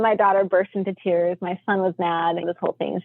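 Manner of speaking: ordinary delivery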